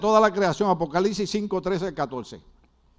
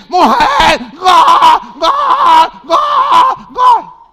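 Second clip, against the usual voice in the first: gritos agudos y eufóricos de gol; gritos de hincha; 10 semitones higher, 15 dB louder, much slower than usual. Acted. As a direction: sacudir a la mujer a puro grito. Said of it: "Perdonen los gritos". (gritar como fanático frente al partido)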